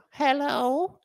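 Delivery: very high, rising quaver-free hello; little old lady. Retold or imitated. Old lady answering her carport door, high little voice. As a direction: sing out a tiny high greeting as an old lady. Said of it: "This little old lady".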